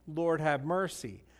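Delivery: ordinary talk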